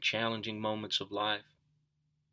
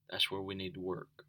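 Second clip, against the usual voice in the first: much softer repeat of That's where we need to work; soft echo; 9 dB quieter than usual. Real, not acted. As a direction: let it sink in, softly. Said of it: "repeated softer".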